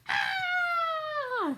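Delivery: high, short falling shriek; startled witch shriek; witch's scream. Acted. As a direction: shriek as you go into the oven. Witch pushed into the oven.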